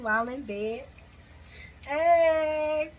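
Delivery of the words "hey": drawn-out teasing "hey"; playful cheer. Real, not acted. Recapper teasing a kissing couple, playful cheer. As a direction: hold it long and level, playfully.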